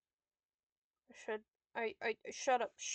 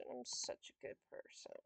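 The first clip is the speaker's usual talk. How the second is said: breathless whisper to himself; 12 semitones lower, 13 dB quieter than usual. Real, not acted. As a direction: whisper it to yourself, almost no voice. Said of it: "self-praise muttered to self".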